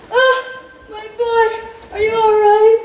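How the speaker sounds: tearful relief. A narrator's reading. Father holding his rescued son, crying out in relief. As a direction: build to a loud worried question. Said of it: "His father cried and held him".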